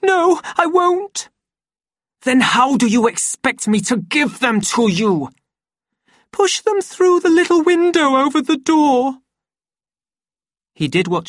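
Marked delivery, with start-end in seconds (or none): 0.0-1.3 very high, loud, slow voice; frightened refusal. A narrator's reading. Lenina refusing to open to the Savage, high scared voice. 2.3-5.3 high, loud irritated demand; exasperated anger. A narrator's reading. Savage arguing through the bathroom door, loud exasperation. 6.3-9.2 very high, loud instruction; anxious calling out. A narrator's reading. Lenina calling from the locked bathroom, high anxious shout.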